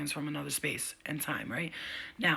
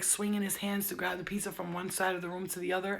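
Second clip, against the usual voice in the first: ordinary talk, nothing marked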